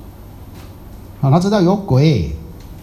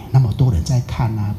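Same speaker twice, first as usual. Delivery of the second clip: ordinary talk, nothing marked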